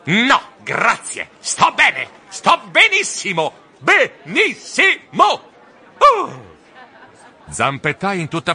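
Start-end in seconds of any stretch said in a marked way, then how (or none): 0.1-6.6 rising louder and higher to a shouted benissimo; insist, losing patience; irritated shriek. Acted. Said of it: "Io strillai stizzito". (strillo stizzito crescente)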